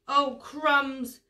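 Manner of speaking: mildly alarmed elephant; gulping elephant. A narrator's reading. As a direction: gulp out the worry, lightly acted. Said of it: "gulped the elephant".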